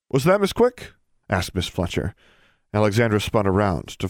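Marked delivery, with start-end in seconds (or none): 0.1-0.9 high, rising query; questioning. A narrator's reading. Custodian catching the student talking, high query. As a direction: call it out as a question.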